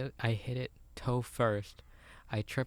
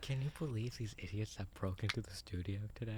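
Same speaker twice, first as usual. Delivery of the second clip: quiet voice; 6 dB quieter than usual. Pretend. Mock ASMR banter.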